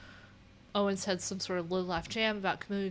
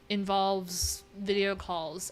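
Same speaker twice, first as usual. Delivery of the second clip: ordinary delivery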